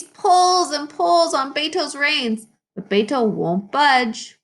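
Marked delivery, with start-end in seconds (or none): none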